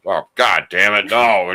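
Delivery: mock dad freaking out, a little loud; mildly hammed-up fatherly exasperation; exasperated dad. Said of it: "freaking out on me".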